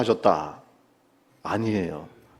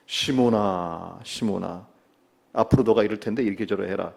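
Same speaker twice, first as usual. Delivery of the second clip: gentle admonishing; 7 semitones lower, 8 dB quieter than usual. Acted. Jesus forewarns Simon before his betrayal, gentle admonishing. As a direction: warn him kindly in a lowered voice. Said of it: "뭔가 부드럽게 타이르는 겁니다".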